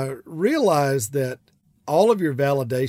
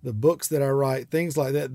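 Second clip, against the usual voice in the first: usual speaking voice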